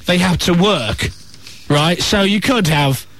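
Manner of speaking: ordinary talk, nothing marked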